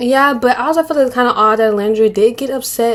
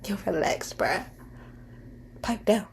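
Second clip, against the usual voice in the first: much softer, brushing someone off; how a rude brush-off comes across, said softly; dismissive hush; 15 dB quieter than usual. Retold or imitated. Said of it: "it's coming off like".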